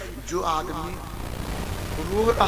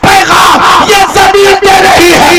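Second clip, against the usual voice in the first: loud, slightly higher fervent sermon; full-force preaching; 5 semitones higher, 13 dB louder than usual. Acted. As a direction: proclaim the miracle to the crowd. (पूरे जोश से ऊँची आवाज़ में तक़रीर)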